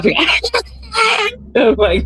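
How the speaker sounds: high, breaking into laughter; laughing hard. Real, not acted. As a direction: crack up while talking. Laughing at granny.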